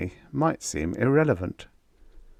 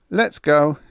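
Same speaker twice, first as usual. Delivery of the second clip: louder, firm command to the bears; commandingly; 8 semitones higher, 5 dB louder than usual. Acted. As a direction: order the bears to move out. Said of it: "he said commandingly".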